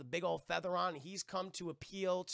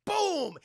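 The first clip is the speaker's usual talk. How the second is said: loud, high boom; imitated gunshot; 12 semitones higher, 8 dB louder than usual. Retelling Jack firing his pistol, shouted boom.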